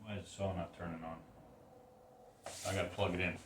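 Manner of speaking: muttering to self. Real, not acted. Puzzled aside, saw won't start.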